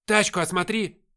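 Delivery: loud, high sergeant's command; требовательный приказ. A narrator's reading. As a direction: shout a demand to a subordinate. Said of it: "потребовал сержант". (требовательный громкий приказ)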